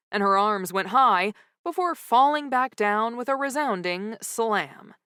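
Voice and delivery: ordinary delivery, nothing marked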